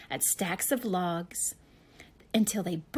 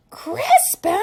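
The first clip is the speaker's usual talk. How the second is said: very high, loud shout; father shouting; 13 semitones higher, 12 dB louder than usual. Acted. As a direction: yell his name in alarm. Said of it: "shouted his father".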